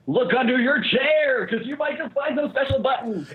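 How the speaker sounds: hammed-up talk-show giveaway hollering; mock giveaway host. Mock Oprah-style giveaway announcement.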